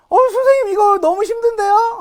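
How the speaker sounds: very high, loud student whine; mock overwhelmed student voice; imitated complaining student. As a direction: put on a pupil's protest to teacher.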